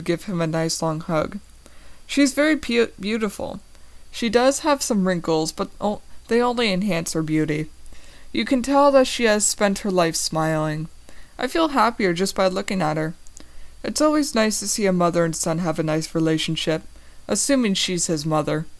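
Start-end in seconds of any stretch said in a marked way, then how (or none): none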